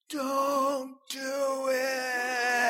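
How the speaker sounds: high, drawn-out ghostly whisper of temptation; tempting inner voice. Retold or imitated. Pastor voicing the inner voice urging a convert, eerie and drawn out. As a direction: stretch it out like a voice in his head. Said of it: "that voice that's going".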